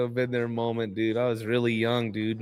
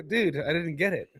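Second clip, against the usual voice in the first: mock bewildered; 5 semitones higher than usual. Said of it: "I'm like".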